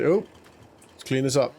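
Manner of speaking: ordinary talk, nothing marked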